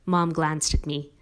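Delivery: ordinary delivery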